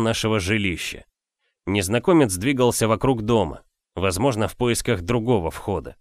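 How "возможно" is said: ordinary delivery, nothing marked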